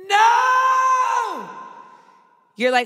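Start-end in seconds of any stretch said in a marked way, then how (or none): 0.1-1.5 high cry of no; hammed-up despairing cry; anguished no. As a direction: wail as he dies. Rickon dies, viewer yells.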